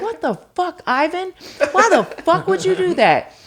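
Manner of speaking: replaying a furious outburst at the therapist; retold angry cursing. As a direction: chew him out as if he just ruined everything. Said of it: "I was so pissed".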